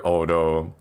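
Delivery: normal talking voice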